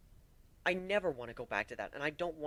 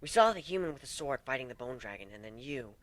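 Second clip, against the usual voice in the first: usual speaking voice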